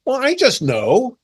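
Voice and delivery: smug mock reply. Acted argument, critic's reply.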